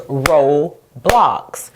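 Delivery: "Roblox" broken into two separate syllables with a pause; split into syllables. Shown as a demonstration. Teacher showing children two syllables, "Roblox" slowly split apart. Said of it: "made from two syllables".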